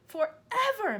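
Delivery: put-on high, loud upset child; a girl crying out the worst; despairing cry. A narrator's reading. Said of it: "Matilda cried".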